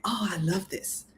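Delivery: soft breathy whisper of delight. Retold or imitated. Coach imitating a team member who loves the work, in a soft whisper. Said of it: "they're just gonna be lit up just like".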